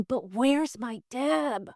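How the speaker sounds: a long, plaintive retold cry for her; anguished. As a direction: imitate his anguished plea, stretched slowly. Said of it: "it's anguishing him".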